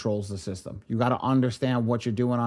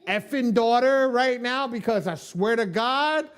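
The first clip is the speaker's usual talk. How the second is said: mock exasperated plea; 9 semitones higher than usual. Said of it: "I was like".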